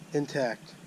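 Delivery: usual speaking voice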